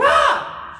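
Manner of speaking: high, loud scream; acted scream. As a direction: shriek as mom does. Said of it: "I'm gonna scream".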